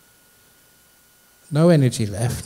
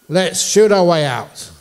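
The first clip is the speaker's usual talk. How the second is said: loud, higher, drawn-out battle call; rallying soldier; 6 semitones higher, 6 dB louder, slower than usual. Retold or imitated. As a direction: act out a soldier rousing the troops. Soldier rallying his surrounded patrol.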